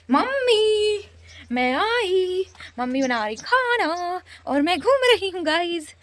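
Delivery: high, playful sing-song calling mom. Acted. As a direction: lilt it high like a cheeky kid.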